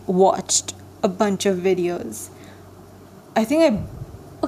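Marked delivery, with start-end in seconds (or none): 3.3-4.5 swallowing a burp mid-sentence; stifled burp. Real, not acted. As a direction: hold back a burp while talking. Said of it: "'Cause I just did that".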